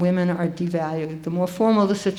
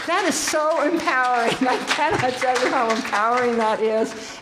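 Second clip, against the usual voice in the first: delighted; 4 semitones higher than usual. Real, not acted. Laughing, delighted.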